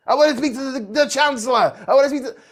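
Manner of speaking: mocking Boris insisting on the Chancellor. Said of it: "Boris Johnson".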